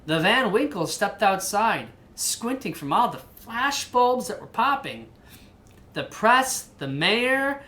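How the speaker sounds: lively picture-book narration; reading to a child. A narrator's reading. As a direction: read the story aloud to your kid.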